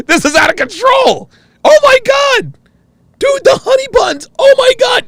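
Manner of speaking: high, loud squeals of delight; excited shouting. Real, not acted. Streamer shrieking over honey buns to chat. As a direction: shriek at the snack list.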